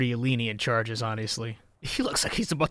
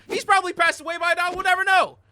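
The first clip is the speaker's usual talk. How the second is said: mock dramatic; 4 dB louder than usual. Mock tragic about the actor.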